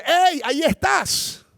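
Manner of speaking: high, drawn-out delighted hail; stretched, high-pitched mock shout; acted calling out. Acted greeting mirroring the shout.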